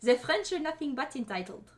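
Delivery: a half-hearted mock French accent. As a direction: put on a bad French accent, deliberately. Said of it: "How dare you make me do a French accent".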